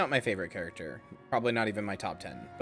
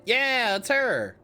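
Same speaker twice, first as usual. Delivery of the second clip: high, loud burst of delight; thrilled to see her; excited; 10 semitones higher, 6 dB louder, much slower than usual. Real, not acted. Recognizes favorite character excitedly.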